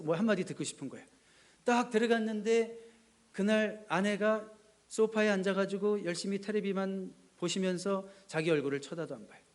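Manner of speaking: ordinary talk, nothing marked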